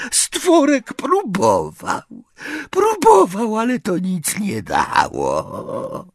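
very high, slow, wailing elf voice; moaning house-elf. A narrator's reading. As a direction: moan it out, squeaky and hopeless. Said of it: "jęknął skrzat".

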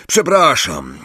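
apology. A narrator's reading. Ron to Angelina after his mistake, apologetic. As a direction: say sorry after a telling-off.

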